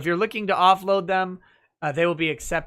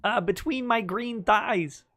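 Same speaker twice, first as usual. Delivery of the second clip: Kermit voice; 7 semitones higher than usual. Pretend. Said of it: "say something unchristian to Miss Piggy".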